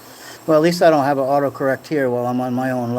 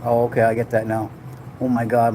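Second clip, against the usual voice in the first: ordinary talk, nothing marked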